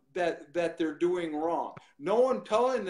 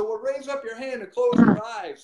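louder, higher instruction; retold command; 8 semitones higher, 6 dB louder than usual. Preacher instructing a boy before praying, raised commanding voice. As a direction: call out the instruction with raised pitch.

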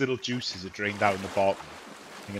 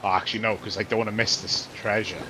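normal talking voice

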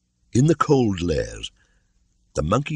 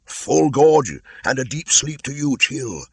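higher, louder call to the sky; cried out; 11 semitones higher, 5 dB louder, slower than usual. Acted. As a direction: call up to the departing kite. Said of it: "cried Bagheera".

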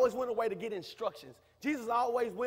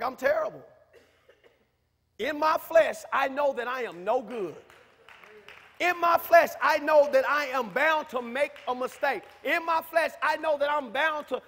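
louder preaching confession; 4 semitones higher, 8 dB louder than usual. Acted. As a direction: confess it to the church with force. Confessing loudly to the church.

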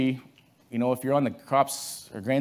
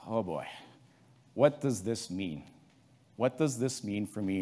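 soft, slow, troubled; worried dismay; 3 semitones lower, 7 dB quieter than usual. Retold or imitated. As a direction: slowly ask it to himself in dread. Farmer facing crop loss.

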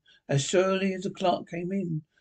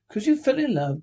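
raised, incredulous growl as a question; growled echo; 6 semitones higher, 3 dB louder than usual. A narrator's reading. Scrooge echoing his nephew's reason, growling. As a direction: throw it back as a mild growl. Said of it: "growled Scrooge".

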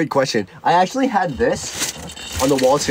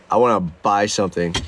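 retold self, low; 10 semitones lower than usual. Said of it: "And I was like".

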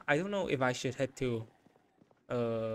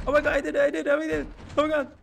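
high, loud relieved yelling; frantic triumph; elated shouting; 13 semitones higher, 7 dB louder, much faster than usual. Real, not acted. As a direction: shout in disbelief at your own escape. Escaped the zombies in game.

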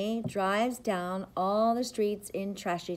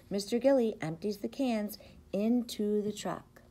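usual speaking voice

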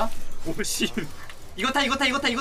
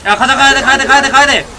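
loud, pushing the team forward; urgent shouting; 5 semitones higher, 5 dB louder than usual. Real, not acted. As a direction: shout them into the fight. Urgent shouting during fight.

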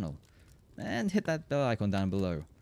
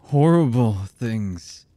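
loud, slow burst of anguish; anguished outburst; 12 dB louder, much slower than usual. Acted. Confessing past deeds to the one who believed, anguished outburst. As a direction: let the guilt burst out, drawn out.

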